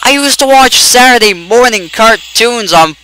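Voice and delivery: high, drawn-out protest; high-pitched outraged rant; indignant outcry. Real, not acted. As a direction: cry out in exasperation, stretching the words. Outraged at losing on Saturday.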